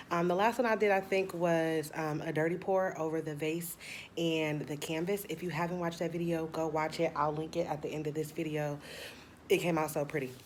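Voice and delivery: ordinary talk, nothing marked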